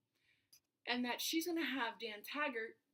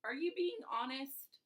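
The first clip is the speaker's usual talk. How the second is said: high, disbelieving "Really?" then slow and louder; incredulous; 10 semitones higher, 5 dB louder than usual. Retold or imitated. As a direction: ask it in mock disbelief. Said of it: "I'm just looking at you like".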